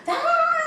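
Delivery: high piercing yell; retold screaming fit; shrill mock scream. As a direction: let out a hammed-up scream.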